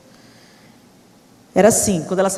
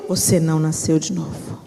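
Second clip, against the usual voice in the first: mocking low voice; 8 semitones lower than usual. Daughter mocks her mother's repeated verdict, low droning. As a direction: drone the verdict like a broken record.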